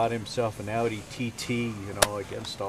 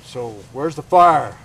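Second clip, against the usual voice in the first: stern cop; 2 semitones higher, 10 dB louder than usual. Retold or imitated. Cop questions a speeding driver.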